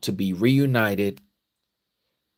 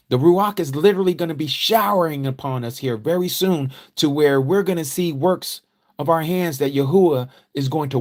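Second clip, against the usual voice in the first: higher, louder, rising fervour; fervent preaching; 8 semitones higher, 3 dB louder than usual. Acted. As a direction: preach it out to the congregation with fire. Preaching builds to full force.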